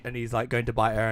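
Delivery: ordinary delivery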